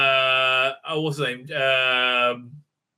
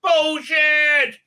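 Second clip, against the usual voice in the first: much higher, loud furious yell; angry outburst; 11 semitones higher, 5 dB louder, much slower than usual. Retold or imitated. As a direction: explode with fury. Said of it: "He's like".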